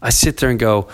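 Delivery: normal talking voice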